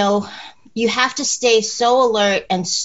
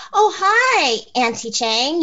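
high bright "Oh, hi" to an auntie; friendly greeting; 5 semitones higher, much slower than usual. Shown as a demonstration. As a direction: greet an old friend with delight. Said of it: "I'll go right up and I'll say".

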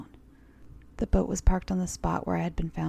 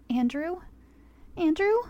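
higher, louder calling out; frantic calling; 11 semitones higher, 6 dB louder than usual. Acted. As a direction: call for the lost child. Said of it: "A woman began yelling".

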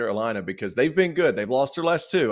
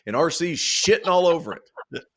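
ordinary delivery

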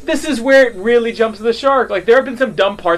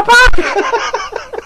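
Gungan voice; 4 dB louder than usual. Pretend. Voicing Captain Tarpals, Gungan.

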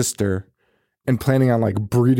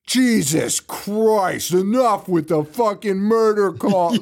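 high, drawn-out mock exasperation; hammed-up furious cop outburst; 7 semitones higher, slower than usual. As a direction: play an exasperated cop fed up with callers. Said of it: "the cops were just furious".

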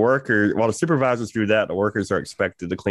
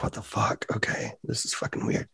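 hushed bafflement; 8 dB quieter than usual. Retold or imitated. Worker retelling finding his vape missing, hushed bafflement. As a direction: mutter it in puzzled disbelief.

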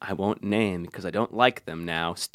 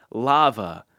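normal talking voice

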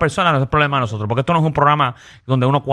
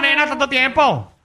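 mock familiar greeting; 12 semitones higher, slower than usual. Mock greeting to first-time caller.

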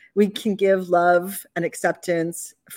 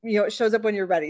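ordinary talk, nothing marked

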